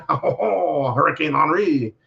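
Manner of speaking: put-on playful French accent with a drawn-out Oh. Host repeating the hurricane's name for fun, in a mock French accent. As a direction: ham up the French on Henri. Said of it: "in your best Fre-, French accent".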